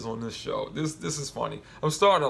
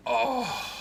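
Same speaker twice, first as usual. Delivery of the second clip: mock surprise; 11 semitones higher than usual. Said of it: "I'm like".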